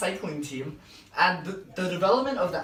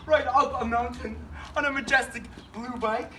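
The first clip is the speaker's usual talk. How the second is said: cheesy ad read; 6 semitones higher than usual. Acted. Pitching a blue bike to viewers, hammed-up commercial voice. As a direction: sell the blue bike like an infomercial host.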